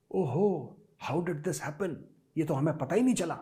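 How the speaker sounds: soft, whiny mock surprise; mock whining. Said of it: "बाद में चू चू मत करना". (नरम, रोनी-सी नकली हैरानी)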